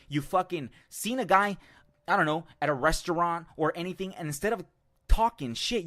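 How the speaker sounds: usual speaking voice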